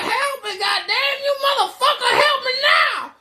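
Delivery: screamed at a very high pitch; furious demand. Retold or imitated. Storyteller voices a fallen man demanding help, furious. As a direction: bellow it out, stretching the last words.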